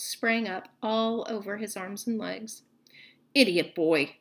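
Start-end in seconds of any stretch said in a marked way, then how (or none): 3.3-4.2 lower, louder snarl; low contemptuous snarl at the boy. A narrator's reading. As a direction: spit the insult out low and loud. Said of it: "snarled Snape".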